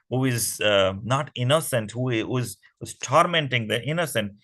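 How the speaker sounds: usual speaking voice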